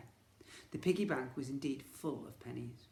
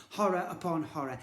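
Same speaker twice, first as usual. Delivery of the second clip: plain reading voice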